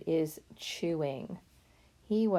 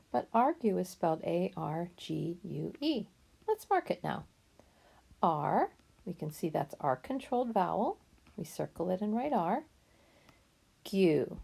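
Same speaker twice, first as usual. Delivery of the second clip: ordinary talk, nothing marked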